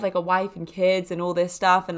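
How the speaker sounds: normal talking voice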